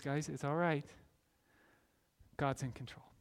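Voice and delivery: gentle comfort. Retold or imitated. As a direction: soothe frightened brothers. Joseph comforting brothers.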